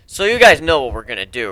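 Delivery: usual speaking voice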